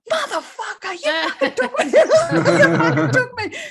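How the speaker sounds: high, loud laughing outburst; laughing outcry. Retold or imitated. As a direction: yell it as you crack up. Realising aliens took her.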